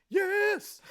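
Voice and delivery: high, loud singing bear; cartoon bear voice. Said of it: "they be like".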